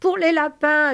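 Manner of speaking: high, loud joyful holler; excited yell. Acted. Lénie bursts into the farm dream, excited yell. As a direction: shout it out with delight. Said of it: "hurla Lénie".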